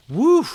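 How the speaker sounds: big high loud whoo of release; loud whoop. Real, not acted. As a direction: let out a whooping cry after the chapter. Reader exhales after chapter ends.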